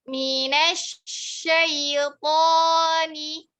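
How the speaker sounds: Minasyaitoni drawn out slowly, mouth open; slow tajwid recitation. Shown as a demonstration. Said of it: "Buka lagi mulutnya".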